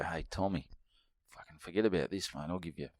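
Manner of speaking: sneaky hushed aside to a mate; hushed side deal. Pretend. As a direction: drop to a low murmur, conspiratorial. Conspiratorial side-deal suggestion.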